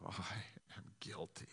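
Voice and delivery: whispered I am guilty, barely voiced; hushed confession. Preacher retelling a dying man's confession, whispered.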